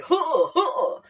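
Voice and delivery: high grunted huh huh; mock rapper ad-lib grunt. Imitating the rapper's ad-lib for the viewers. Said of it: "I'ma be like".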